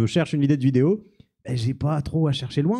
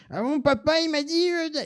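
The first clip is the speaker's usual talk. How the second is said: put-on high-pitched kid voice; Jean Kévin; 14 semitones higher than usual. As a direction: play a thirteen-year-old boy, squeaky and put-on. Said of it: "je prends une voix de gamin". (voix de gamin haut perchée)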